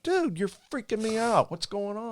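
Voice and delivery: freaked out. Retold or imitated. Attendant spooked by the pilot looking around, high and panicky. Scared in parking lot.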